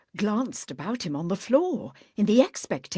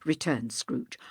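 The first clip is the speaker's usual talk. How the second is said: ordinary talk, nothing marked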